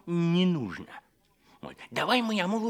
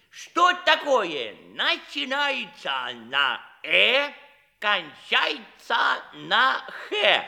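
slow, drawn-out riddle with a laugh; slow teasing riddle; 2 semitones lower, slower than usual. Acted. The servant teases the prince with a riddle, slow and laughing.

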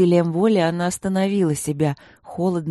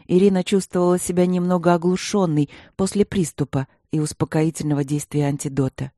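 plain reading voice

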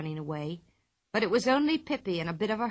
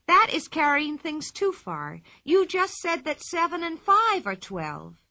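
higher, louder, slow scolding; indignant lecture; 8 semitones higher, 5 dB louder than usual. A narrator's reading. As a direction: put the teacher in her place. Pippi lectures the teacher.

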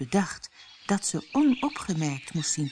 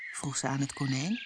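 usual speaking voice

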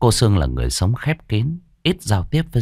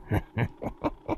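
put-on chuckling, crazed old hag's laugh; eerie villain chuckle, broken into bursts; creepy cackle; 12 dB quieter than usual. A narrator's reading. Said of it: "mụ cười khùng khục".

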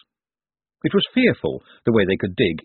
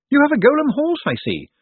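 high, raised voice of a reporter calling out; shouting; 4 dB louder than usual. A narrator's reading. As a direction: shout it up high over the din. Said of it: "she shouted as she reached him".

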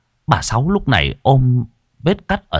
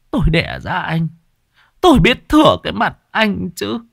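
knowing scorn; 9 semitones higher than usual. Acted. Mother sees through her son.

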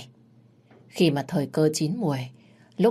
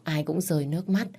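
ordinary delivery

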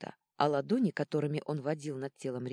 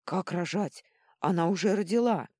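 loud, indignant protest; roaring; 4 semitones higher, 7 dB louder than usual. A narrator's reading. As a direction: shout back in disbelief. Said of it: "взревела Клози". (возмущённый крик)

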